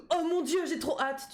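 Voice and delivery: mock excited gasp. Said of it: "je suis pas autant en mode".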